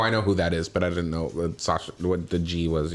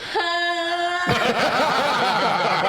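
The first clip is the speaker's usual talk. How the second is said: train noise. Acted. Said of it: "I forgot about that".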